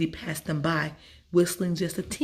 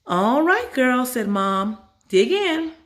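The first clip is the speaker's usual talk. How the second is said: higher, louder cheery mom; Mom serving; 6 semitones higher, 4 dB louder, slower than usual. Acted. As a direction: invite the girls to dig in.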